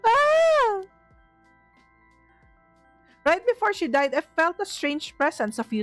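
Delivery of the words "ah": high frightened scream. Real, not acted. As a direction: shriek in fright. Jump scare in horror game.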